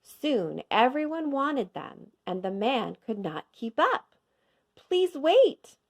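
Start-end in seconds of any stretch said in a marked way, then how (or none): 4.8-5.8 higher and louder, flustered; hurried plea. A narrator's reading. Cook begs the waiting crowd, louder and higher. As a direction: hold the crowd off in a rush, louder. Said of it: "he said".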